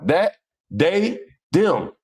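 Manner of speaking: Cincinnati "that, they, them" as dat, dey, dem, pitched high; hard Ds, Cincinnati style, one word at a time; Cincinnati accent. Shown as a demonstration. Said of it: "That's how we talk in Cincinnati".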